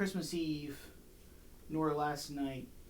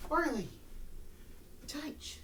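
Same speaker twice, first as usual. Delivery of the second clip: high dog call; 12 semitones higher, 5 dB quieter, much slower than usual. Real, not acted. Calling dog to do touch.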